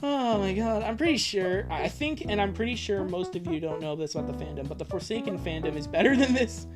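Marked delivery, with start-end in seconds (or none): none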